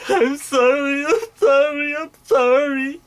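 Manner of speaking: high, whining fake cry; hammed-up high-pitched pleading; mock tearful apology. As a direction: wail it over and over in a squeaky mock sob. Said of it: "doing it and then".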